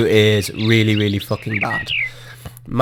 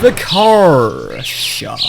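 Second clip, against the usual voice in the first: drawn-out, higher announcement; grand naming; 6 semitones higher, 4 dB louder, much slower than usual. Pretend. Player naming his bus stop, slow and grand. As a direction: christen it slowly like an unveiling.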